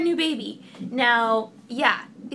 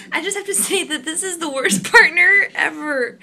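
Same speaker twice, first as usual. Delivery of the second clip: laughing; 5 semitones higher, 8 dB louder than usual. Real, not acted. Laughing through labor complaint.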